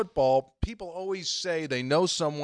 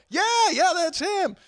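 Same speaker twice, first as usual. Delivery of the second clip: high, louder eager confirmation; thrilled to confirm the name; excited; 5 dB louder than usual. Retold or imitated. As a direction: burst out yes, that's him.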